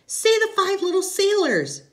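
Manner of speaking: put-on high, loud child's voice; little girl's high, loud demand to her daddy. A narrator's reading. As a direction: pipe up as an eager small daughter. Little girl voiced in story.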